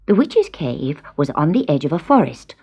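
usual speaking voice

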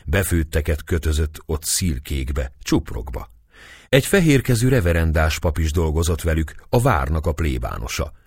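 plain reading voice

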